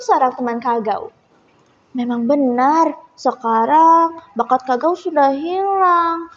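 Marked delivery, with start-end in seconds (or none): none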